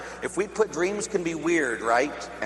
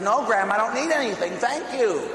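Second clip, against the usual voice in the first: higher, emphatic no to grandma; acted firm refusal, pitched up high; insistent mock refusal; 7 semitones higher, 4 dB louder than usual. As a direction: protest harder when pushed. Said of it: "And she pushed the guy and says".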